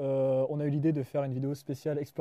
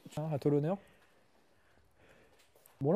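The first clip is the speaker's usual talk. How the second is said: ordinary delivery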